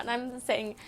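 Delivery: usual speaking voice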